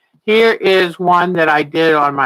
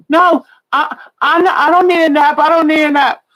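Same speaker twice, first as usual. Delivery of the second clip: put-on high, louder protesting little kid; whining child; 10 semitones higher, 4 dB louder than usual. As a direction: mimic a tired child refusing a nap. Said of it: "'Cause they'll say".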